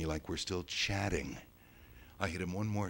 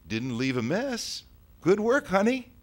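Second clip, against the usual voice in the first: high, lilting mom voice rising louder; praising mother; 14 semitones higher, 7 dB louder than usual. Acted. Mom to child after noticing knife and hammer, praising mother.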